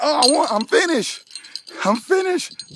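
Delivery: puffing out triumphant words between breaths. Real, not acted. Teacher just finished a 5K, out of breath and excited. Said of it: "it sounds like I'm out of breath".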